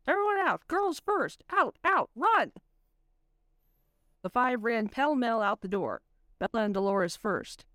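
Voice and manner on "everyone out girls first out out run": high urgent calls to flee; calling out. Acted. Matthias ordering everyone out of the haunted room, high and urgent. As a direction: yell them all out of the room. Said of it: "Matthias called".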